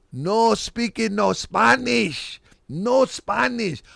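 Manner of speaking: high, very slow mock Spanish accent; fake Spanish accent. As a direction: stretch every word in a put-on Spanish accent. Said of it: "I'm saying it with an accent".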